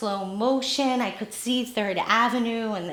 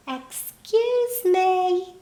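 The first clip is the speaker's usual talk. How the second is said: put-on much higher, slow little-old-woman call; 9 semitones higher, much slower than usual. Little old woman calls again to the waitress, much higher and slow. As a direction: lift it up high and slow to be noticed. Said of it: "I heard this little voice".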